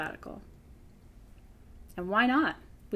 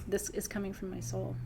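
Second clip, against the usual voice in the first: repeated softly; 8 dB quieter than usual. Real, not acted. Repeats line, then softer.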